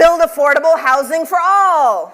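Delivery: high, loud prompt; emphatic call-out. Acted. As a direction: call it out to the room. Prompting audience call and response.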